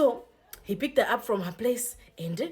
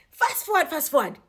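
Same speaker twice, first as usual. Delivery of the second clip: much higher and louder call; emphatic skip; 8 semitones higher, 4 dB louder than usual. Real, not acted. Vlogger hurries the plot along, high and loud. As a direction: jump the story forward with a high, loud call. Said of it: "skipping ahead in the story".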